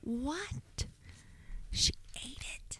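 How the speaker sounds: stunned, breathless whisper; shocked whisper. Real, not acted. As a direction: whisper it in disbelief. Shocked whisper at contamination news.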